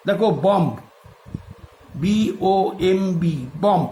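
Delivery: ordinary talk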